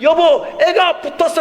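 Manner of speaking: high, loud, breaking the big news; excited news. Retold or imitated. Father phoning his wife about the acceptance, excited and high-pitched. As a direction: call out thrilled to your wife. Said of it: "아내한테 전화해서".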